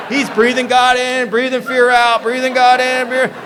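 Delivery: high, very loud, drawn-out chant; excited shouting. Real, not acted. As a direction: shout it out in amazed rhythm. Storyteller excitedly shouting over crowd reaction.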